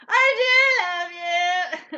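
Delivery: slow, high, very loud mock wail; hammed-up wail. Woman finally confessing to boyfriend, wailing melodramatically. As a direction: stretch it into a comic, overwrought cry. Retold belated outburst of love.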